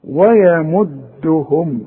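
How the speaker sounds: ويمدهم very slow with light و and ي; slow thin recitation. Shown as a demonstration. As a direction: draw out ويمدهم thinly. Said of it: "اوعى تفتح لي الميم".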